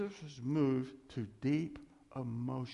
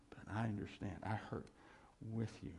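low, soft and understanding; hushed compassion; tender reassurance; 5 semitones lower, 8 dB quieter, faster than usual. Acted. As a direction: console quietly, pausing before the last words. Voices God's comforting answer.

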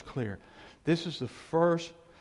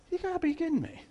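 incredulous Jesus; faster than usual. Retold or imitated. Jesus reacting in heaven.

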